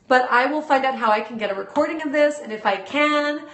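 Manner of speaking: ordinary delivery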